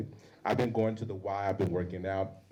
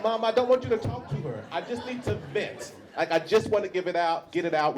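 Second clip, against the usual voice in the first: higher, louder upset pleading; pleading to mom; 9 semitones higher, 5 dB louder than usual. Retold or imitated. College student on phone begging mom to let her vent, upset high pleading. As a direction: beg mom not to call, voice strained high.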